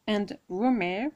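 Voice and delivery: clumsy French-accented English word, said slowly; botched English accent. Real, not acted. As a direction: stumble through the English scent name. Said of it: "oh, mon accent, qu'est-ce qu'il est pourri". (anglais maladroit avec un fort accent français)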